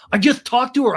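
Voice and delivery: high, loud mock protest; hammed-up defensive outburst; Sandoval. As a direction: imitate an insistent man shouting his excuse. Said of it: "And Sandoval's like".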